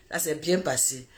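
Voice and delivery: répété tout bas, presque pour soi; écho murmuré. Retold or imitated. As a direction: laisse tomber la phrase dans un souffle. Répétition beaucoup plus douce. (répété tout bas, distraitement)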